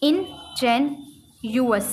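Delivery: इनजेन्युअस stretched slowly syllable by syllable; careful slow demo of इनजेन्युअस; slow model word. Shown as a demonstration. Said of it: "इंजेन्युअस".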